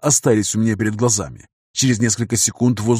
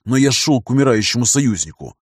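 ordinary delivery, nothing marked

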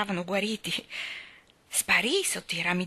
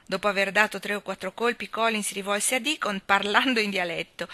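ordinary delivery

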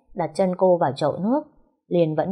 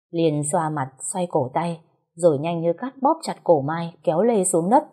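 usual speaking voice

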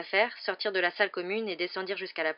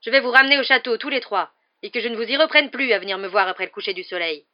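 loud, higher angry scolding; 5 semitones higher, 12 dB louder than usual. Acted. Hagrid marches them back to the castle, angry. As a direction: send them off in fury. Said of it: "dit Hagrid avec colère".

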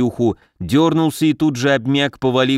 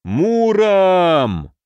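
high, drawn-out cry of grief; anguished cry; 6 semitones higher than usual. A narrator's reading. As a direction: call the name out high, in anguish. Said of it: "Добрыня горестно закричал". (горестный протяжный крик)

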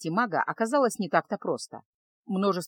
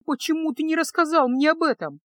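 плача; 8 semitones higher, 3 dB louder than usual. A narrator's reading. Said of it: "заплакала Феска".

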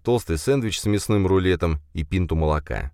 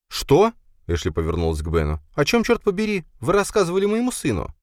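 higher, shocked and indignant mother; 12 semitones higher than usual. A narrator's reading. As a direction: demand an answer, scandalized. Ashley shocked at Ben.

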